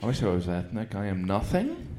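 usual speaking voice

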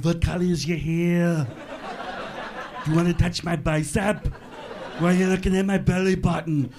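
lightly voiced action-star interrogation; Arnold Schwarzenegger. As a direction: ask silly questions like a tough action hero. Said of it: "He can ask any question that sounds cool".

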